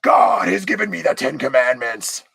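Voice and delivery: booming high prophet voice; Moses proclaiming. Pretend. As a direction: shout it like a prophet on the mountain. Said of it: "Moses coming down from the mountain".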